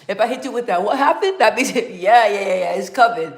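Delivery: loud laughter through the words; laughing loudly. Real, not acted. Bursting out laughing at chat.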